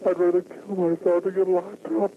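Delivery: ordinary talk, nothing marked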